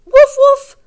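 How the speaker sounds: high, loud yappy puppy woofs; puppy bark. Acted. Teacher reading Biscuit, barking as the puppy. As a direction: bark like a little puppy for the kids.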